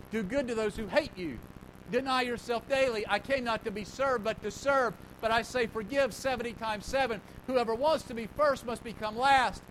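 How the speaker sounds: preaching the words of Jesus with full force; forceful scripture reading. Real, not acted. Reads Jesus' sayings with force.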